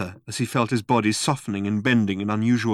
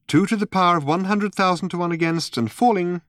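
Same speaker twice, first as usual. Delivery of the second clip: higher, composed announcing voice; sensible girl's voice; 8 semitones higher than usual. A narrator's reading. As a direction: read out the odds sensibly, pitched up. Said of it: "heard a girl's voice".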